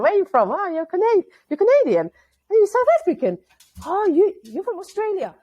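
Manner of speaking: chirpy guesses from strangers; people guessing. Retold or imitated. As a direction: strangers eagerly guessing where she's from. People guessing her accent origin.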